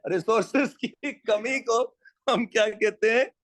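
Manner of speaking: ordinary delivery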